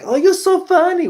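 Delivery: high, bright mock Gal Gadot accent gush. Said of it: "with her weird accent".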